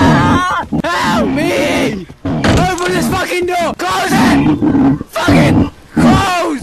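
high, loud, frantic yells; panicked shouting. Real, not acted. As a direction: scream orders as the monster closes in. Trying to shut door on monster.

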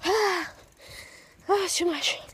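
winded and worn out; out of breath. Real, not acted. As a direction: sigh it out, exhausted. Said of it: "I am not good with stamina".

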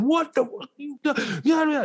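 high, startled challenge from a stranger; a rough guy snapping back in shock; hostile soldier. Retold or imitated. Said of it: "He said".

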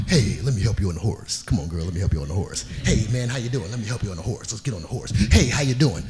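low suave greeting to the riders; deep, silky flirty helper; smooth low charmer. Retold or imitated. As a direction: drop the voice low and smooth, playing the ladies' man. Greeting women at horse line.

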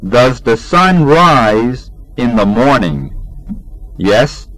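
usual speaking voice

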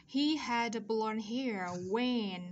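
"when" with no h sound, in a slow model sentence; slow, careful teacher's model, "when" without the h; silent h. Shown as a demonstration. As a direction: read "He had blonde hair when" very slowly, h silent in when. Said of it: "H âm câm chúng ta không đọc".

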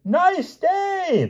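very high hearty call; proclaiming. A narrator's reading. Whole company exchanges greetings, proclaiming. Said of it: "they all proclaimed".

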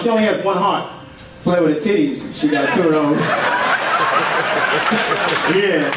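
ordinary talk

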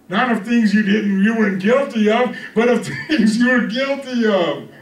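fervent pulpit insistence; impassioned preaching. Real, not acted. Preaches at full force.

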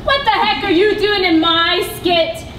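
ordinary delivery

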